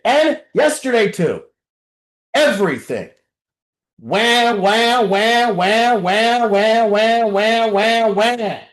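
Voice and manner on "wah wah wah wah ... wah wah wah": mocking baby wah wah; mock crybaby wailing; mock whining wahs. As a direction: whine it out like a crybaby.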